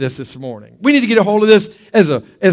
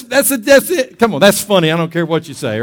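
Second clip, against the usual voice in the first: normal talking voice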